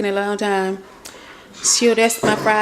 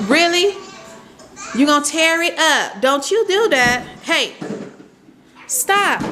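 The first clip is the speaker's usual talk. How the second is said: scolding; 7 semitones higher than usual. Real, not acted. Mom scolds baby Halo for tearing it up, high and sharp.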